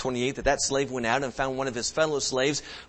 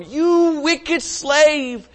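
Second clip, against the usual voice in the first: very slow, high, louder scolding of the slave; stern rebuke; 10 semitones higher, 7 dB louder, slower than usual. Retold or imitated. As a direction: rebuke the wicked slave slowly. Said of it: "his lord said to him".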